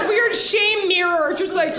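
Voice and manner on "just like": ordinary talk, nothing marked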